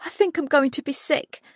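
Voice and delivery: high, queasy voice about to throw up; queasy panic. A narrator's reading. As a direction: sound sick and panicked. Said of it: "rushed into the cubicle".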